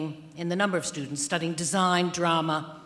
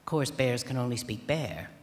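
ordinary delivery

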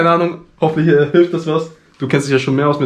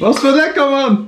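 high silly voice; 10 semitones higher, slower than usual. Pretend. Joking exclamation, high voice.

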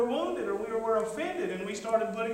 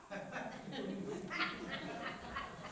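big laugh; 3 semitones higher, 14 dB quieter than usual. Real, not acted. Laughs after mock crying.

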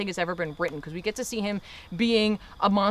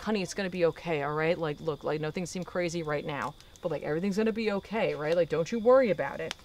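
softer, hushed fatherly comfort; soothing reassurance; 2 semitones lower, 4 dB quieter than usual. Retold or imitated. Dad calming his daughter, soft reassurance. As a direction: gently play down the danger.

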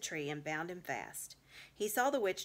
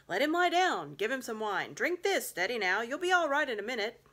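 higher and louder, bustling and kind; rescuers' voices; 5 semitones higher, 7 dB louder than usual. Acted. Rescuers tending Edmund after the rescue, loud hearty reassurance. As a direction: call out eager comfort over him.

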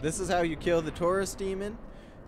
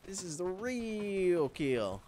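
ordinary delivery

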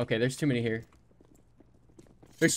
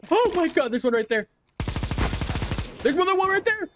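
frantic excitement; faster than usual. Real, not acted. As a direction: shout the callouts in a panic. Spotting enemies mid-fight.

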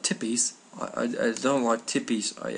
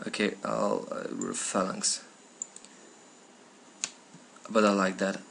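normal talking voice

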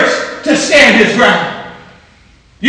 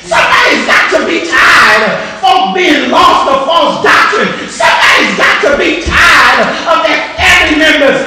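full-force gospel preaching; fiery preaching; 4 semitones higher than usual. Acted. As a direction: preach it out to the congregation. Rousing repeated refrain.

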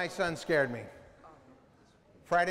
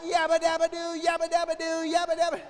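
panicked shouting; 9 semitones higher than usual. Retold or imitated. Startled dad shouting repeatedly at his son. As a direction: yell in a scared frenzy. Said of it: "I'm like".

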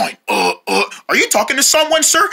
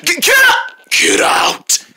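scary voice; 11 semitones higher, slower than usual. Acted. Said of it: "That scary voice".

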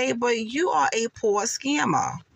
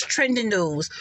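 normal talking voice

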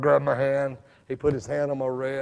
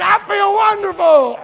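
high, loud ecstatic shout; joyful shout; 12 dB louder than usual. Retold or imitated. Preacher shouting his joy on the creek bank to the crowd. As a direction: cry it out in pure joy. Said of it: "And I was still shouting".

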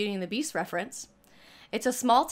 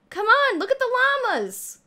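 high, louder gushing squeal; excited squeal; 13 semitones higher, 5 dB louder than usual. Real, not acted. Streamer showing viewers the llamas, excited squeal. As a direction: squeal with delight, pitched way up.

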